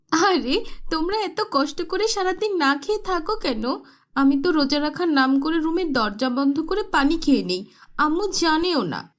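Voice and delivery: put-on sly girl boasting; spoiled girl. (গোপনে খাওয়া নিয়ে বড়াই করা মেয়ে)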